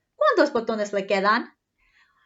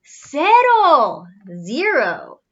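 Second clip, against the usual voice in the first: excited answer; 3 semitones higher, 8 dB louder, much slower than usual. Acted. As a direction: shout the answer gleefully.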